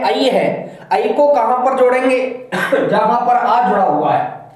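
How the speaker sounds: normal talking voice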